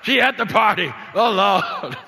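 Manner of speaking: loud, high, laughing alarm; hammed-up horror at mom at the party; laughing mock panic. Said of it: "I tried to get away from that voice".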